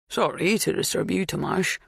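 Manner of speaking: courteous, gently apologetic request; polite. A narrator's reading. Neighbour disturbing a farmhand at his door, polite. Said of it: "Bernard said politely".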